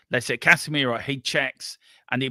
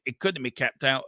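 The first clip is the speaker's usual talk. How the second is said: ordinary talk, nothing marked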